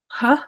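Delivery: "huh" with a breathy aspirated H. Shown as a demonstration. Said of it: "it sounds like".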